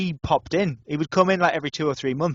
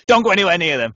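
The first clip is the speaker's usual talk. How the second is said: louder, quick imitated warning; mock stern warning; 3 semitones higher, 8 dB louder, faster than usual. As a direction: rap out the boss's warning, half laughing. Landlord's stern warning, acted out.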